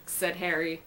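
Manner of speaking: ordinary delivery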